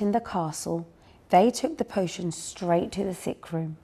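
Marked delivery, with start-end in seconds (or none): none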